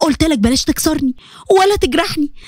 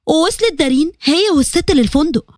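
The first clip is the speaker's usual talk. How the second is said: ordinary delivery, nothing marked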